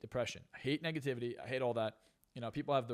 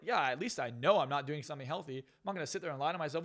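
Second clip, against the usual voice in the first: ordinary talk, nothing marked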